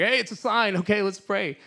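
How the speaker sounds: high, loud eager self-talk; mock excited inner voice. Banker urging himself to pray, high and eager. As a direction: hype yourself up, pitched high.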